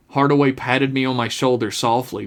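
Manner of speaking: plain reading voice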